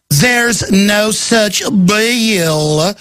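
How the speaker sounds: very slow, drawn-out mock Southern drawl; cartoon character. Host mocking the governor denying the bill, cartoonish drawl. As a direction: drag every word out like a cartoon Southerner. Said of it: "like a cartoon character".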